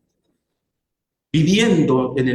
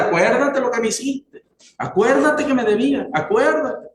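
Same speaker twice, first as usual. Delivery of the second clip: mock grudging reproach; 6 semitones higher than usual. Resentful person holding a grudge.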